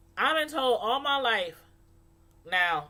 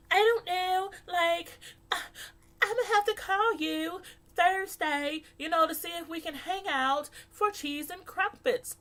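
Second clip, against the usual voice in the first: put-on higher, prissy valley girl voice; mock valley girl; 7 semitones higher than usual. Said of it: "you sound like a valley girl".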